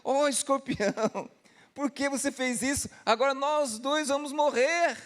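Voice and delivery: a hint of an outraged frog; indignant frog. Frog reproaches the scorpion for stinging him, indignant. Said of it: "o sapo indignado".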